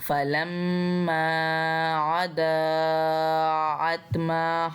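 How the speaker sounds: slow, drawn-out tajweed chant; Quran recitation. Shown as a demonstration. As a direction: recite slowly, stretching the vowels. Quran recitation between explanations. (धीमी तजवीद वाली तिलावत)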